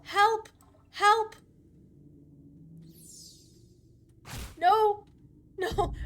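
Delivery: high, loud desperate cries; screaming for help. Real, not acted. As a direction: scream for help in real panic.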